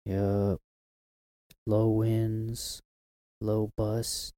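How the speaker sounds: a light forecaster read; mock weather forecast. Announcing the wind has passed, lightly like a forecaster.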